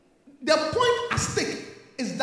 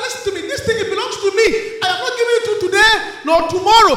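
raised, forceful voice, building to a shout; confrontational demand; 3 semitones higher, 8 dB louder, faster than usual. Acted. Believer starting a necessary quarrel over what's theirs, loud and forceful. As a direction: stake your claim, louder with each phrase. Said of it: "Sometimes you must say that".

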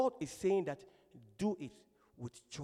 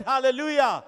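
louder, higher hallelujah, demanding an answer; insistent repeated call; 5 semitones higher, 13 dB louder than usual. Real, not acted. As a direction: press the congregation to respond. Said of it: "I said".

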